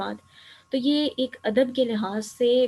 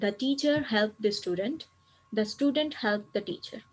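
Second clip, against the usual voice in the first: slow dictation of meanings; much slower than usual. Shown as a demonstration. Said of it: "meaning یہ لکھے ہوئے ہیں".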